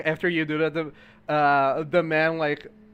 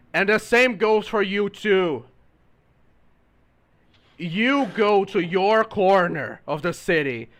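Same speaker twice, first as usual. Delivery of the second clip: slow, firm order, louder; stern vendor; 3 semitones higher, 5 dB louder than usual. Acted. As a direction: send them off sternly. Vendor orders player away.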